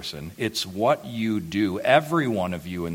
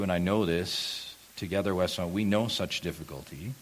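usual speaking voice

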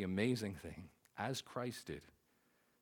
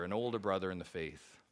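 ordinary talk, nothing marked

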